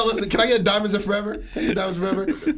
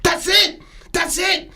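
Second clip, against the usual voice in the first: shouting; 10 semitones higher, slower than usual. Real, not acted. Shouting his point at co-hosts.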